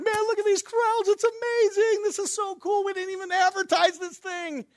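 very high, gushing fanboy voice; mock over-excitement. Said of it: "so excited".

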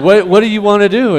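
high, loud laughing question; husband laughing at his wife's idea. Retold or imitated. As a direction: ask it while cracking up.

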